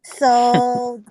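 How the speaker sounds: normal talking voice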